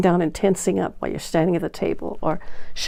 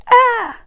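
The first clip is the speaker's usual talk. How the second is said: high nervous yelp; sudden high yelp; 7 dB louder than usual. Shown as a demonstration. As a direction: squeal a short high "Ah!". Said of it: "You say".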